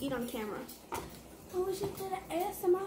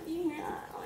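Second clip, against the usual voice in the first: whispering; 6 dB quieter, faster than usual. Real, not acted. Listening to the crunch.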